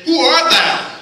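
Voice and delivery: loud, high challenge; startled demand. Acted. Boaz waking to find a woman at his feet, startled demand.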